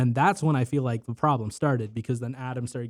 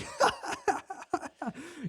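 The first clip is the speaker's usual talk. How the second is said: high-pitched mock maniacal cackle; maniacal laugh; 5 dB quieter than usual. Assistant manager answers being challenged, imitated maniacal laugh. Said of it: "like, fucking maniacal laugh".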